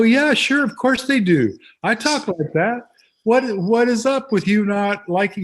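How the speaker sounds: high, loud mock-indignant comeback; indignant retort. Playwright retorting to critics of the dialogue, high loud indignant retort.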